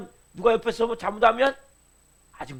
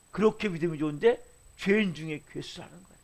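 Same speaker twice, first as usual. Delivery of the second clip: normal talking voice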